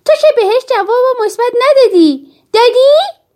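high, loud outburst of disbelief; loud, high-pitched child's protest. A narrator's reading. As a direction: blurt it out at full voice, rising to a shocked question. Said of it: "با صدای بسیار بلند گفتم".

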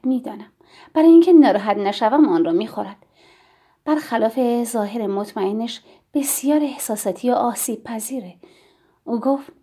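ordinary delivery, nothing marked

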